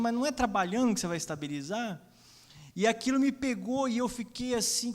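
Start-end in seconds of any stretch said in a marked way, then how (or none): 0.0-2.0 raised, louder disbelieving comeback; incredulous retort. Retold or imitated. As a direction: throw the words back in disbelief. Acted incredulous retort to her excuse.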